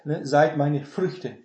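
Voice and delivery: usual speaking voice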